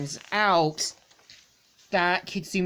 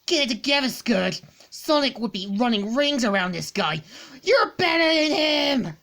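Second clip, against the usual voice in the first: furious pep talk; 7 semitones higher, 3 dB louder than usual. Acted. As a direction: bark at herself to get it together. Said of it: "she starts to get more furious".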